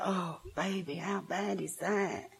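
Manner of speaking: soft, slow cooing sympathy voice; cooing pity. Retold or imitated. A child fishing for pity, imitating a soft, slow, cooing sympathiser. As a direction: coo it out like a pitying auntie.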